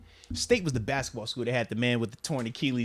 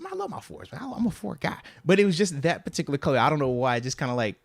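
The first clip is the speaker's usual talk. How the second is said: ordinary delivery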